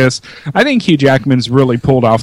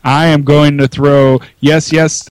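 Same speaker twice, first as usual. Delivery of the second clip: usual speaking voice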